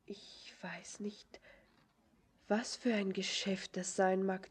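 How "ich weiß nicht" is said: slow, soft, voiceless whisper; hesitant whisper. Acted. Girl puzzling over the stranger's errand, in a slow whisper. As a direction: draw it out quietly, unsure.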